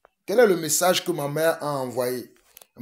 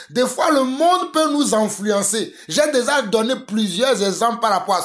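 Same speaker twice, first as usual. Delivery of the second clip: preaching at full force; 7 semitones higher than usual. Acted. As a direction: preach it out, climbing higher. Preaches to the church.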